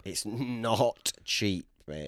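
"It's not cheap" stretched very slowly; slow mocking echo. Agreeing with his friend's complaint, drawn out. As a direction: drag the echo out.

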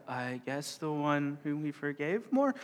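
slow, soft, unsure mock-panic guess; panicked guess. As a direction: answer like you suddenly sense a trap. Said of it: "you could just see that panic".

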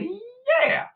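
high, squeaky hesitant yeah; drawn-up, doubtful little yelp; mock reluctant yes. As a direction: agree through an acted wince. Acts out his reluctant agreement.

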